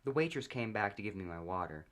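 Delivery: ordinary delivery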